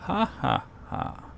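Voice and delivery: mocking laugh. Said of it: "he jeered".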